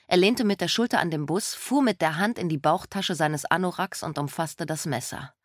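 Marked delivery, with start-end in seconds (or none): none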